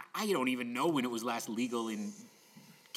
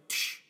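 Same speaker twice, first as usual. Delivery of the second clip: imitated hiss of a match being struck; short 'tsh' of a match flaring; match-strike sound. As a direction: make the sound of matches lighting. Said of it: "matches go like".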